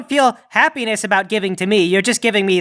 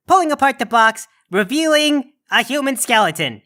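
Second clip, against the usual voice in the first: usual speaking voice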